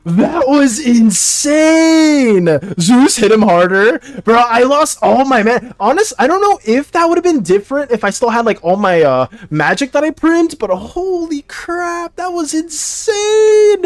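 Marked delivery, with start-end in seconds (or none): 0.0-2.5 very drawn-out exclamation; stunned amazement. Real, not acted. Gamer reeling from the boss fight, slow stunned exclamation. 10.7-13.8 high, slow, stretched-out marvel; amazed exclamation. Real, not acted. Gamer marvelling at the lost fight, high and drawn out.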